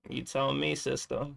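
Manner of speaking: ordinary delivery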